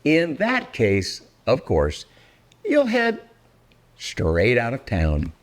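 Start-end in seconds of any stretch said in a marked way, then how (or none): none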